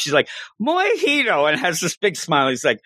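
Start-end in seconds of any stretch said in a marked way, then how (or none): 0.6-1.5 Mojito, high-pitched, first syllable said as "moy"; mispronounced name. Retold or imitated. Girl retrying after being corrected, high mispronounced "Moy-hee-to". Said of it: "That's not how you pronounce it".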